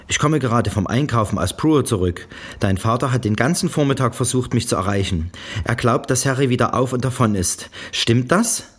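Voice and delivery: höher und lauter, barsch und unbeteiligt; barsche Mutter. A narrator's reading. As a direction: kurz angebunden wie eine kalte Mutter. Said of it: "unbeteiligt und barsch". (Mutter ruft Tochter an, barsch und unbeteiligt)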